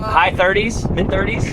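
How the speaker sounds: loud, high, thrilled; excited. Real, not acted. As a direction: still buzzing after the dive. Guessing fish weight, pumped.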